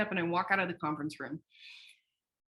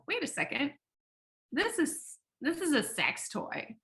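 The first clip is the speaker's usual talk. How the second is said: organizer objecting; 7 semitones higher, 4 dB quieter than usual. Retold or imitated. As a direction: play the startled show official catching it. Show organizers objecting to product.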